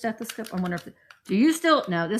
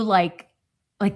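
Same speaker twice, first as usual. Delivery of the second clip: ordinary talk, nothing marked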